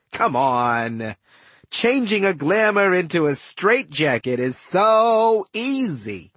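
condescending villain with big pitch swings; smug trickster villain. Acted. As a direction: toy with a helpless captive, stretching key words. Villain Setna gloats over bound captive.

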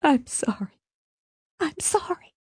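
high, thin tearful moan; weak, high, distraught apology. A narrator's reading. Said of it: "she moaned".

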